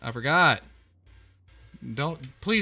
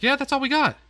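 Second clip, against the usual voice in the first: high, louder squeaky mock answer; mock high reply; 13 semitones higher, 5 dB louder, faster than usual. Gamer voices the host answering the guest, mocking. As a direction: toss it off in a silly high voice. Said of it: "And it's like".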